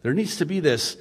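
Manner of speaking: ordinary talk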